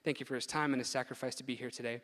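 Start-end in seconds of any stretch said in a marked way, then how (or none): none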